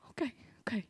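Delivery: put-on whispered, meek child's reply; tearful whisper. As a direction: answer softly after crying. Crying child answers grandpa.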